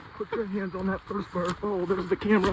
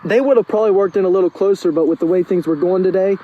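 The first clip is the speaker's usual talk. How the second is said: loud; 8 dB louder than usual. Real, not acted. Loud excited recounting.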